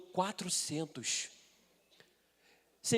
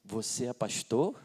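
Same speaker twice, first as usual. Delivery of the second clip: low, slow, rising question; pointed query; 7 semitones lower, slower than usual. Retold or imitated. Lady on next treadmill to pastor, slow pointed question. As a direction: draw it out, eyeing the pastor. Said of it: "Sabe aquele olhar assim".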